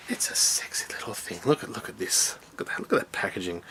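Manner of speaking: hushed, breathy admiring whisper. Real, not acted. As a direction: whisper it like a secret treasure.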